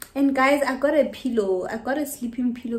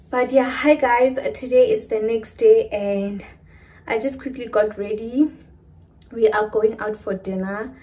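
out of breath; 7 dB louder than usual. Real, not acted. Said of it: "and I'm out of breath".

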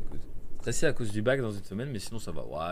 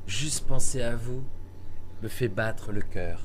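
romantic reading; 5 dB louder, slower than usual. Acted. Reading translated love letter.

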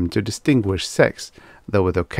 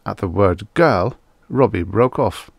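ordinary delivery

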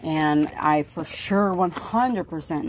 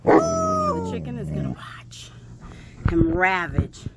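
hushed narrator aside; whisper; slower than usual. Real, not acted. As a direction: lean in and whisper it.

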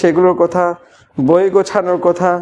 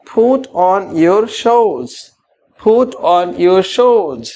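slow model phrase; much slower than usual. Shown as a demonstration. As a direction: put on your shoes said very slowly, word by word. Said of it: "slow model phrase for repeating".

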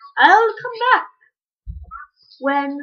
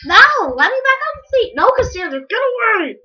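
higher, louder sibling protest; annoyed protest; 8 semitones higher, 5 dB louder, faster than usual. Real, not acted. Kid tells sister Cassandra to get off the seat, annoyed. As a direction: push your sister away.

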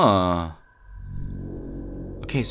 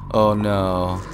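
normal talking voice

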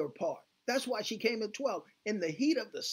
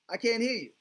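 louder, pushing the crowd to answer; rally them, call it out higher and louder; 4 semitones higher, 6 dB louder than usual. Real, not acted. Preacher urges congregation to repeat.

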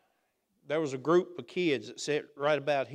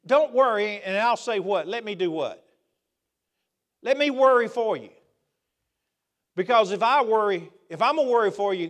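loud, raised preacher voice; hospital pastor preaching against worry; 6 semitones higher, 8 dB louder than usual. Acted. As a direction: work the crowd with call and response. Preaching at full force.

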